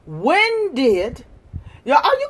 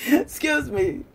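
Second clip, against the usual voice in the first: laughing; 6 dB quieter, slower than usual. Real, not acted. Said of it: "I'm laughing because".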